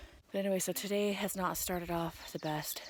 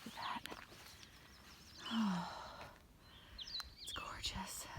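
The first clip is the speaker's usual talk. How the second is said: awed whisper; 12 dB quieter than usual. Real, not acted. Awed by mountain view.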